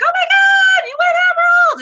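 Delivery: very high, loud squealing cheer; excited shout. Retold or imitated. Coach cheering a teammate's Emerald rank, shrieking with joy. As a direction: scream it like a friend just won. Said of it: "I'd get so excited for them".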